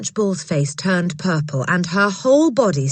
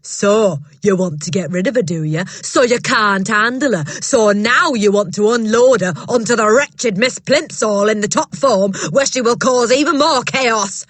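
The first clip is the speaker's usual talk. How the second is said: loud, bullying headmistress voice, higher; booming headmistress; 5 semitones higher, 6 dB louder than usual. Acted. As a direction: bark it down at a timid teacher. Trunchbull voiced in story.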